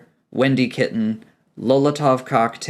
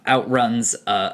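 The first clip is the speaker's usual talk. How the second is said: ordinary delivery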